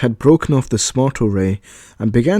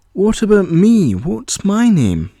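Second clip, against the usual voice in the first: higher, slow plaintive ask; feeling left out; 8 semitones higher, slower than usual. A narrator's reading. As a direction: sound a little left out. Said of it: "said the boy, feeling left out".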